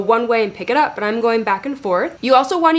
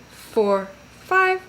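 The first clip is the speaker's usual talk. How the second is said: ordinary delivery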